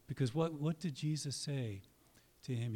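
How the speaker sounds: ordinary talk, nothing marked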